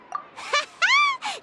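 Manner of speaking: a quick, pleased little laugh high up; proud giggle to her partner; gleeful giggle. Acted. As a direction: giggle at your own clever idea. Paimon pleased with her idea.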